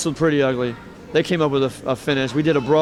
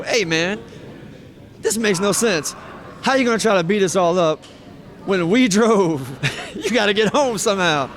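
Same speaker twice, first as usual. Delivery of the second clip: higher-pitched retold protest, breaking into laughter; incredulous challenge; 6 semitones higher than usual. Wrestler challenging the friend he drove with, amused incredulity. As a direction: reason with him in amused disbelief.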